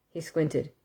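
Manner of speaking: plain reading voice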